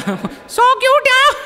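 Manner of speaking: squeaky high, long-drawn mock coo; imitated girly gush, way up high; mimicking the gushing colleague. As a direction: parrot her squeal, stretched right out. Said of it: "इसी आवाज़ में बोला मैंने".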